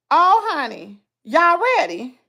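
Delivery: ordinary delivery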